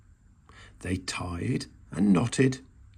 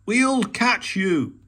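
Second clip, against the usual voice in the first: a loud, very slow higher shout; 9 semitones higher, 5 dB louder, much slower than usual. A narrator's reading. Badger to Mole on the branch, slow loud shout. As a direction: call up reassuringly, drawn out. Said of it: "shouted Badger".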